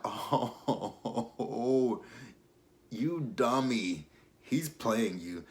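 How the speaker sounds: slow, scornful scolding. Pretend. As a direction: talk down to a fool. Scolding the character, laughing.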